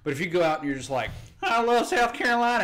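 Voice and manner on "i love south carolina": high, loud hammed-up Southern accent; mock Southern accent. Mocking someone selling state t-shirts, loud Southern accent. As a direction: play a gushing Southern local, pitched up. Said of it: "Well, if you sound like that".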